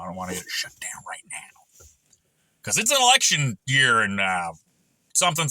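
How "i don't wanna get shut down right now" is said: whisper. Real, not acted. Aside about channel being banned.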